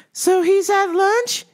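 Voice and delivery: high, slow puzzled question; confused customer. Retold or imitated. Customer not following the clerk, high slow confused question. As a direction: pitch it up, drawn out and clueless.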